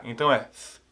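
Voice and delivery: ordinary talk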